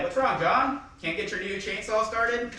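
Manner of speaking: retold jeer, pitched well up; mock taunting. Dad ribbing John over the chainsaw, mock taunting. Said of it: "talking shit to John".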